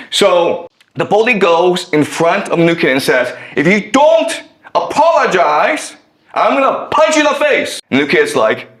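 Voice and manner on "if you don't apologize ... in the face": high-pitched mock menace; acted threat. Bully confronting the new kid, acted angry threat. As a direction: deliver an ultimatum, pitch raised.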